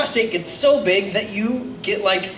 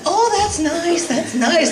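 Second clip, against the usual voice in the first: mock polite; 10 semitones higher than usual. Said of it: "And I was like".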